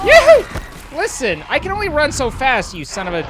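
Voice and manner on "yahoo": very high loud whoop; excited whoop. Real, not acted. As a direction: whoop it with wild glee. Charging ahead.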